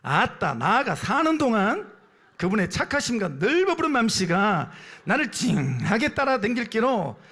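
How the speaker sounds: loud Jeolla dialect with stretched words; 전라도 사투리. Shown as a demonstration. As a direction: ham up a broad Jeolla dialect. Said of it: "전라도 버전입니다". (길게 늘여 빼는 구성진 전라도 사투리)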